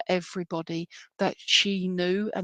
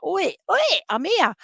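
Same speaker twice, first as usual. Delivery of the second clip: high, playful "oi" calls; sister calling for attention; 10 semitones higher, 4 dB louder than usual. Retold or imitated. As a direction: poke someone with a high-pitched call. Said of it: "it was just like".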